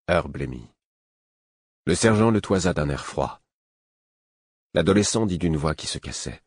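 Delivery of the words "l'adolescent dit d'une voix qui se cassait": ordinary delivery, nothing marked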